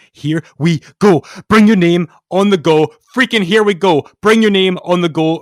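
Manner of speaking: hammed-up loud declaiming of the chorus; loud dramatic declaiming. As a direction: belt the lyrics out like a big finale. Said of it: "do a dramatic reading of the lyrics".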